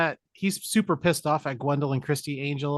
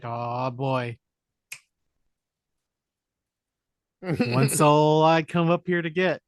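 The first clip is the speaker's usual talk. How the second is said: slow, louder put-on devil voice; mock Satan; 5 dB louder, slower than usual. Retelling the movie, Satan greets Keanu in a slow booming voice. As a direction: ham up a gloating Satan.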